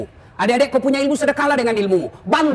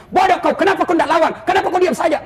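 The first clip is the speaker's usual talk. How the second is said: higher mock scolding of a husband; wife scolding; 7 semitones higher than usual. A wife nagging her policeman husband, in higher mock scolding. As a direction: nag him like a sharp-tongued wife. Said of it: "maki-maki lagi sama istrinya".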